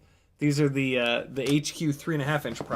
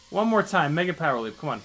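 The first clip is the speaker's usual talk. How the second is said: urging on; faster than usual. Real, not acted. Pilot psyching himself up for the mega power loop, eager urging. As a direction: pipe up to psych yourself up.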